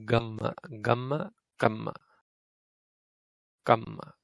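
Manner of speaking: voiced g in "gamma" then unvoiced k in "kamma"; gamma versus kamma. Shown as a demonstration. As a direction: contrast the first consonant. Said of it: "hard to even hear the difference".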